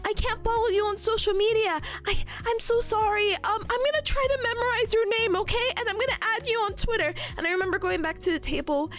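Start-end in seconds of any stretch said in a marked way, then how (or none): 0.0-7.1 high, imitated drunken apology; tipsy earnest gushing. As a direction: gush at a new friend, tipsy and apologetic. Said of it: "I was getting quite drunk".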